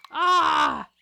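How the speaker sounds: loud high squeal of joy; delighted squeal. Real, not acted. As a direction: squeal at something cute. Seeing cute slime.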